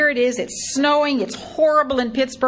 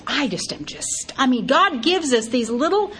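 ordinary talk, nothing marked

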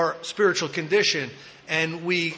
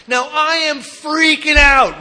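ordinary talk, nothing marked

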